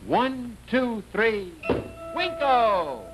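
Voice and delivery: put-on kids party magician. Host counts down to magic word.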